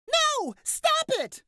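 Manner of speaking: very high, loud panicked scream; woman screaming. Acted. Woman fleeing a car at night, screaming out. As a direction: shriek it high in protest. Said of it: "a woman screams out".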